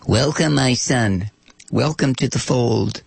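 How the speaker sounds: slow, unctuous mock preacher. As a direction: welcome the sinner slowly, pious.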